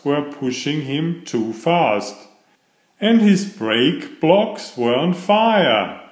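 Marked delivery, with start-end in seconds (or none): none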